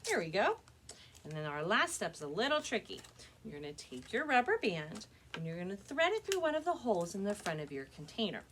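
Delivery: normal talking voice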